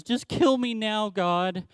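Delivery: a petulant prophet throwing a fit at God; dramatic tantrum. Retold or imitated. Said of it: "he gets so dramatic and says".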